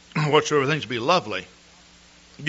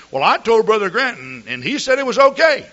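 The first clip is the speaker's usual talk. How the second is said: quoting the caller higher and louder, eager to justify; mock eager excuse; 10 semitones higher, 9 dB louder than usual. Preacher retelling a congregant's excuse, eager and raised. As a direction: play the caller brushing it off on the pastor.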